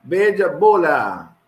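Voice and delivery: normal talking voice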